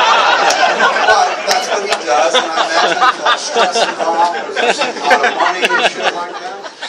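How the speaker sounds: talking through loud laughter; laughing. Real, not acted. Still cracking up at his own joke, laughing. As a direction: laugh the words out high and loud.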